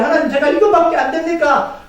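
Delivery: high, dismayed appeal; acted pleading prayer. Preacher acts believer kneeling before God, pleading.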